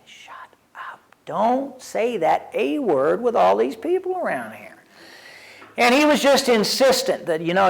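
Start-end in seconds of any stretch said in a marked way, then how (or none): 0.1-4.8 hushed whisper. Retold or imitated. Inner thought, hushing a friend.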